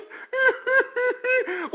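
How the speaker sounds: high whimpering fake sob; a hammed-up wail between words; mock sob. Mocking the crying victim, fake high sob. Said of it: "she was sitting there crying".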